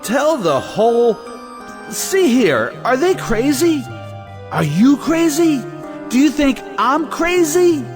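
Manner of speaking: high-pitched, incredulous protest; incredulous, high indignation; indignant outburst. Acted. Indignant outburst in an acted quarrel.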